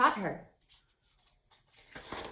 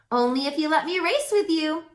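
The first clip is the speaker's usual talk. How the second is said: a little higher, playful; lightly voiced unicorn; 7 semitones higher, faster than usual. A narrator's reading. Jazz teases the horses, lightly voiced. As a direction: bargain playfully.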